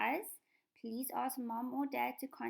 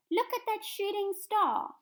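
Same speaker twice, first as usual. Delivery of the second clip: high, loud and slow with wonder; thrilled, drawn-out exclamation for the class; excited exclamation; 10 semitones higher, 8 dB louder than usual. Shown as a demonstration. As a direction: gasp it out like you just spotted a shooting star. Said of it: "a feeling like excitement".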